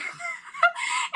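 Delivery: a sudden high burst of laughter. Real, not acted. Telling the grocery list story, a high laugh. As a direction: let a high laugh break out.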